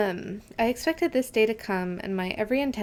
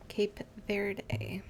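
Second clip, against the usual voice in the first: whispered to self; 9 dB quieter than usual. Real, not acted. Reading map names quietly.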